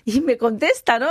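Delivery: usual speaking voice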